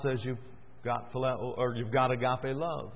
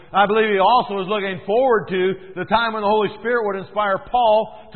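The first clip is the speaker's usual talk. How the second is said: higher, louder rapid pulpit delivery; fiery preacher building his case; preaching at full force; 7 semitones higher, 9 dB louder than usual. Acted. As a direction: pound the point home to the congregation.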